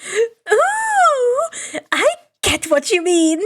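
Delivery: high, thrilled ooh of discovery; light up at the idea; enthusiastic. Acted. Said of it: "Hatsume said enthusiastically".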